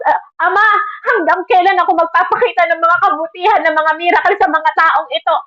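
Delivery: a lightly voiced, pained Jesus asking how long; pleading Jesus. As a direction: plead to the Father, weary of people who won't believe. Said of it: "Parang ganun yung sinasabi ng Diyos".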